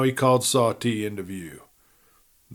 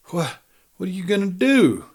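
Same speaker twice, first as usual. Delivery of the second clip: breathless stammer, pitched higher; gasped in fear; 7 semitones higher than usual. A narrator's reading. Mine manager gasps at the outlaw beside the dynamite, high frightened stammer. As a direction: gasp it out, scared stiff. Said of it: "he gasped out".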